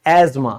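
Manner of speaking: एजमा with a z sound, no th; silent th in asthma. Shown as a demonstration. Teacher gives the English way to say asthma. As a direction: say एजमा, th dropped. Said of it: "अस्थमा ... English में हम इसको क्या कहेंगे".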